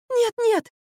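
very high, frightened squeak; panicky babble. A narrator's reading. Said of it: "залепетала Марфа". (испуганный тонкий лепет)

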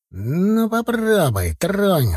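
high, slow, drawn-out growl. Acted. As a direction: dare him to touch you. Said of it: "зарычал Серый".

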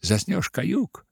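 usual speaking voice

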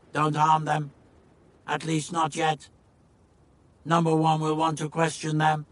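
level, droning alien command; Monoid calling out. Acted. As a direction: order it like an alien machine. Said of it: "number twenty-three called out".

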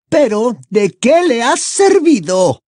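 high, loud scornful question; high-pitched contemptuous female villain; Bellatrix, disdainful. A narrator's reading. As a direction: throw it out with open disdain. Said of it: "repuso Bellatrix con desdén".

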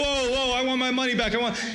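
high, mock-indignant outcry; protesting crowd. Crowd to exposed healer, mock high-pitched protest. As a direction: imitate duped customers clamouring.

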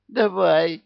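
high squeaky agreement; высоко, пискляво. Acted. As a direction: squeak it out eagerly. Пьяный ответ высоко. (высоким писком)

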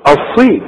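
"الصي" with a thick, emphatic s; heavy emphatic sad. Shown as a demonstration. Teacher says look, then shows heavy ṣ in "الصي". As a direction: show the heavy ṣād in "الصي". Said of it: "شوف".

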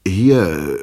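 plain reading voice

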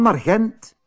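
"naar Gent" pitched high, tossed back cheekily; cheeky. A narrator's reading. Tijl tells mother he's off to Gent, cheekily. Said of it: "Tijl antwoordde brutaal".

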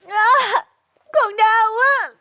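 very high, agonized wail; drawn-out, tearful cry of pain. Acted. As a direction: cry for mother, clutching your belly. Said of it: "Thơ lại la lên, ôm bụng đau đớn".

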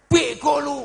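high, very loud drawn-out insult; demonstrated angry yell. Shown as a demonstration. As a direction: bellow it like someone losing their temper, for show. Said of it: "orang marah itu deket tapi teriak".

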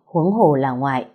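ordinary delivery, nothing marked

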